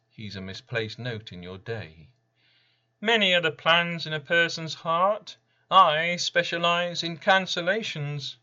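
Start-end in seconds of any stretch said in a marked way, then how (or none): 3.0-8.4 high, loud, eerie mockery; Random's voice. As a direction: recite a proverb as a gloating spirit. Random mocks with a proverb.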